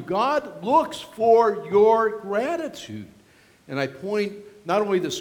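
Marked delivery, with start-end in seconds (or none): 0.0-3.1 slowed right down, every word weighed; deliberate, drawn-out reminder; slow emphatic repeat. Shown as a demonstration. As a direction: drive it home slowly. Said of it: "I remind you".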